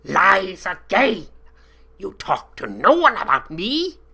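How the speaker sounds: put-on angry accented guard. A narrator's reading. Guard Johann furiously rejecting the captive's lies. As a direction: yell it back at him.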